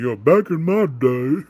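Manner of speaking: put-on slow, loud creaky old man; old-timer reminiscing. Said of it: "like the old man".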